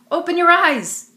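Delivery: high, loud bullying command; demanding. A narrator's reading. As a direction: bark it down at her in the mud. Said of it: "they demanded".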